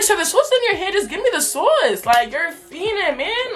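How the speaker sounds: high-pitched, drawn-out exasperation; getting tight. Retold or imitated. Customer to worker holding the sauces, high exasperated demand. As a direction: plead indignantly, pitch way up, words stretched. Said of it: "I'm getting tight".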